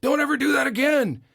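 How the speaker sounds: loud, high scolding shout; whole family snapping at the kid. Retold or imitated. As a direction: yell it as a shaken parent. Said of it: "everybody turns around and goes".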